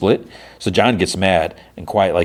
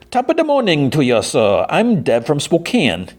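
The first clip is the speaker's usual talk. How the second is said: mock Spokane accent; 8 semitones higher than usual. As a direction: put on a silly Spokane accent, pitched way up. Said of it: "I've never heard a Spokane accent before".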